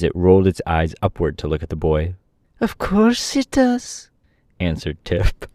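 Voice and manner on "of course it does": high-pitched Tip, drawn out; high slow boy. A narrator's reading. Tip answering the puzzled horse, slow high voice.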